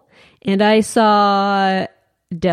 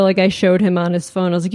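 ordinary talk

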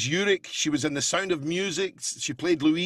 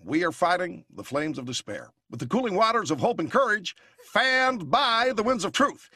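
Obama impression; 2 semitones higher than usual. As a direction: deliver it like a campaign speech. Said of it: "Then we had Obama".

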